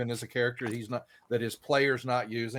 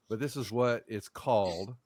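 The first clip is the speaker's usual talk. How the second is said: ordinary talk, nothing marked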